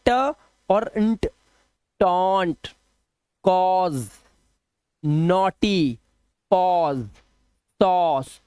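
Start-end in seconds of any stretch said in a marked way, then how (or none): 2.0-8.4 टॉन्ट, कॉज, नॉटी, पॉज, सॉस with a held "aw"; "aw" sound. Shown as a demonstration. Teacher reading examples for pupils, long "aw" vowels. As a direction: read each word apart, drawing out the "aw". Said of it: "ओ की आवाज देखो".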